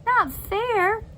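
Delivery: high incredulous echo, rising; indignant. Acted. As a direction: throw her words back in disbelief.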